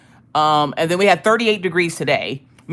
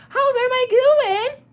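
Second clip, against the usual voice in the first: sing-song greeting; 3 dB louder than usual. Acted. Streamer greeting chat in high voice.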